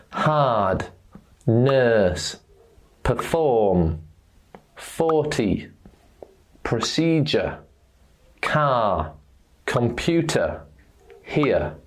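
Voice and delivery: "Hard", "nurse", "car", "computer" with no R sounded; silent R. Shown as a demonstration. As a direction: drop the R after the vowel in "perform", "forty", "here". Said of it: "the letter R is silent".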